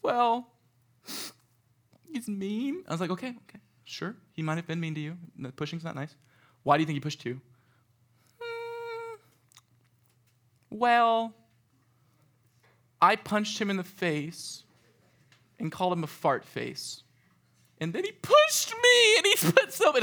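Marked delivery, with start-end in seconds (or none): 0.0-2.8 put-on higher, pouting child; sulky kid. Camper sulking to counselor, higher and pouty. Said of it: "He'd be like". 3.1-7.4 soft, gentle coaxing; calming kid. Retold or imitated. Counselor soothing a crying camper, softly. 8.4-20.0 put-on much higher, slow, guilty child voice; sheepish kid. Camper admitting he punched first, higher and sheepish.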